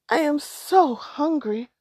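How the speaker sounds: put-on a child begging; hungry small boy. A narrator's reading. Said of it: "said the boy".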